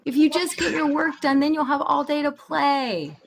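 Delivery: mock lecture to a kid; imitating an exasperated parent; lecturing parent.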